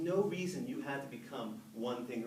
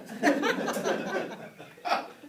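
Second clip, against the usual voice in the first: high laugh; 8 dB louder than usual. Real, not acted. Laughing at audience poses.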